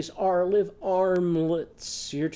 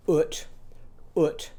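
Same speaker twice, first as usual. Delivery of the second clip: ordinary talk, nothing marked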